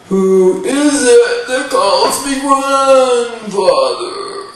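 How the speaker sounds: loud, slow, booming giant; giant Wendigo. Acted. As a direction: draw it out like a huge creature. Giant Wendigo answers the caller.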